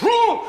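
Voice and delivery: very high, loud startled whoop; mock horse shying in alarm; hammed-up high "woo" of fright; spooked horse whoop. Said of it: "every day they just go".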